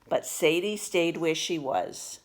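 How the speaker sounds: usual speaking voice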